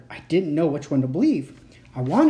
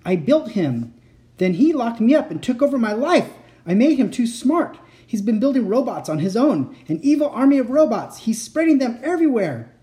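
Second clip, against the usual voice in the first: urgent, high pleading warning; 8 semitones higher, 4 dB louder than usual. A narrator's reading. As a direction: warn her urgently. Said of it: "the man from the closet warned".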